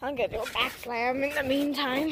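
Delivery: usual speaking voice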